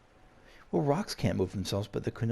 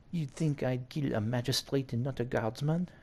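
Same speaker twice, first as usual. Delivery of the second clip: put-on higher-pitched French-accented villain; 4 semitones higher than usual. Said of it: "you want the French accent".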